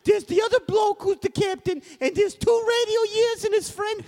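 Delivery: very high, incredulous British official; squeaky mock British Governor; high-pitched Governor. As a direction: recap it in a silly high British voice. Governor repeats the report in sketch.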